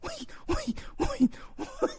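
very high, breathy wheezing laughter; trying to talk through laughing; wheezing giggle; laughing wheeze. Real, not acted.

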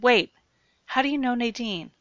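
high, loud sudden alarm; alarmed shout. Acted. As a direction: cut in, shocked. Jared alarmed, interrupting.

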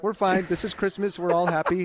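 high, chirpy mock cheer, covering tears; forced cheer. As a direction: pretend all is well in a bright sing-song. Forcing cheer after tearing up.

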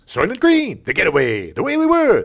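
ordinary talk, nothing marked

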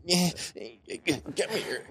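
usual speaking voice

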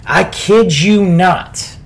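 normal talking voice

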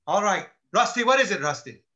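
imitated boss, loud and impatient; exasperated. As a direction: act out grudgingly taking the call. Exasperated lieutenant takes the call.

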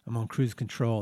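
low, lightly voiced movie star; a hint of Tom Cruise swagger; Tom Cruise impression. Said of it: "That's my Tom Cruise impression".

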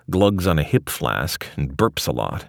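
ordinary delivery, nothing marked

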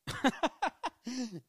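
high burst of laughter. Real, not acted. Man amused by his MySpace and TikTok comparison, high laugh. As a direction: laugh loudly at your own remark.

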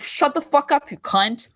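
high-pitched retold comeback, dropping at the end; mock-aggressive retort. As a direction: cut off a heckler, half in jest. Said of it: "I would just get very aggressive".

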